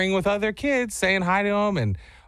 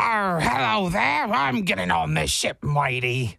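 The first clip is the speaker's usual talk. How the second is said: pirate voice; 3 semitones higher, slower than usual. Pretend. Dad showing his pirate personality on request, mock pirate. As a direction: play the pirate boarding the ship. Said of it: "let's hear it".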